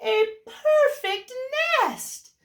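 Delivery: high, thrilled exclamation; a chicken overjoyed at the nest; excited hen. Acted. Chicken finds the perfect nest, thrilled and high. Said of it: "she cried".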